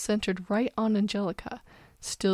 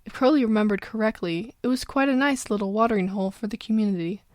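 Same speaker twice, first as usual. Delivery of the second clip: ordinary delivery, nothing marked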